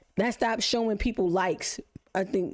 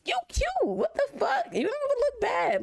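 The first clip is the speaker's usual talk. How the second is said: much higher, hyped-up reassurance; encouraging; 14 semitones higher than usual. Retold or imitated. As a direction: lift her up in a squealy voice. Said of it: "But I'm like".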